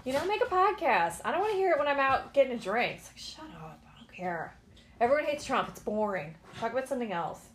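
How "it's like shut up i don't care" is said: soft whispered aside; whispered shut up. Real, not acted. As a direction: hiss it under your breath, fed up. Fed up with political chatter.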